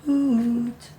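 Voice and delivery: a little braver. Acted. Shy owl calling for his friend. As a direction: try a braver call. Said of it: "Little braver this time".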